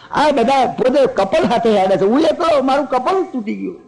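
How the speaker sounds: high, pleading cry of loneliness; lonely lament. Acted. Rama mourns his lost wife, high plaintive cry. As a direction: wail it like a lost husband.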